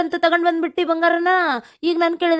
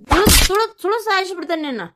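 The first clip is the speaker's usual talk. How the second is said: furious threat; 6 semitones higher than usual. Acted. Drunk husband threatens wife.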